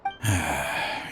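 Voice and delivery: long tired exhale before admitting it; weary sigh. Acted. Old partner to Cyno, a heavy weary sigh. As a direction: sigh out the weight of guilt.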